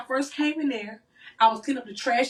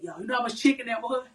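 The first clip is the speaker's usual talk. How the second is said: ordinary talk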